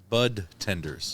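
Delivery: "bud tenders" said slowly, clear voiced d in "bud"; careful correction. Shown as a demonstration. Correcting a co-host who heard "butt tenders", slow and careful. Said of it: "No, I said".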